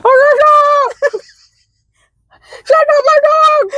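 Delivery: high wailing garbled words; tongueless speech. Pretend.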